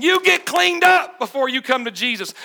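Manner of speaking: loud, preachy scold of a judgmental church; mocking stern church. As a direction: shout it like a finger-wagging church lady. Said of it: "They're saying".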